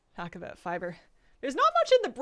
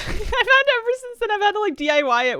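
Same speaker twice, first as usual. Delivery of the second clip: laughing; 13 semitones higher, 10 dB louder than usual. Real, not acted. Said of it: "sorry, I'm out of breath".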